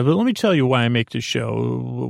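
ordinary delivery

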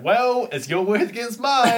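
high, drawn-out cartoon schemer; smug rival. Rival corners Jackson, high mock-smug voice. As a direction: gloat in a put-on high voice.

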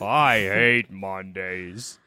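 very low, slow lazy cat; Garfield. Acted. Garfield answering his owner John, fat lazy voice. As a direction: sink into a deep, dragged-out drawl. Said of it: "That's how Garfield sounds".

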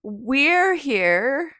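high, drawn-out hail across a maze; mock calling out. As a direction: call out high, stretching each word. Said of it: "yell out and be like".

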